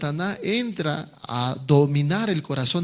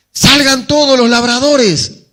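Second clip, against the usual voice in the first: loud, higher order to come out; commanding shout; 6 semitones higher, 8 dB louder than usual. Acted. Vineyard owner orders the tenants out, loud shout.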